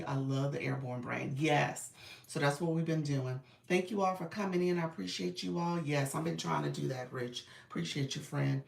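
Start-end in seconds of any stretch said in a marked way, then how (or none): none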